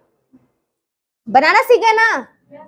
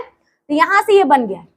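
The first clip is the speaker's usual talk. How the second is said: normal talking voice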